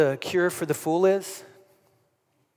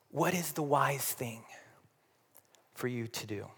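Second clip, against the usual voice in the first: whispered; 6 semitones lower, 15 dB quieter than usual. Real, not acted. Pastor hushes final question.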